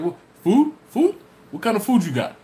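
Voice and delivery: hammed-up disbelief turning to hunger; eager customer. Yonkers customer hearing about food, loud excited high voice.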